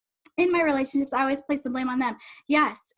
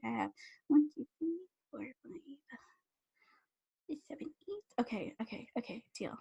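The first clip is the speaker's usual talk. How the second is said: very soft, under the breath; hushed self-talk while tallying; counting to oneself; 12 dB quieter than usual. Real, not acted. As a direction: count privately, barely voiced. Counts viewers to herself.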